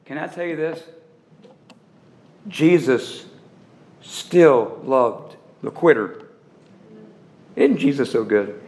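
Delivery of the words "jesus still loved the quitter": spaced, lower, slow and heavy on still loved the quitter; word-by-word emphasis. Acted. Preacher to congregation, slow heavy spaced stress. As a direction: drag it out like a verdict.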